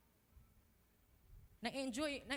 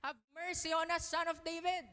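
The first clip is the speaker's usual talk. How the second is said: pleading cry; 7 semitones higher, 5 dB louder than usual. Retold or imitated. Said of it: "ayaw sabihin na".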